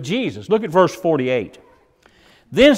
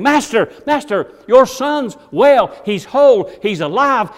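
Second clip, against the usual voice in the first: rising, louder, breathless joy; excited servants; 7 semitones higher, 6 dB louder than usual. Retold or imitated. Servants rushing to the father, calling out joyfully. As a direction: burst out with the news of the healing. Servants rush with good news.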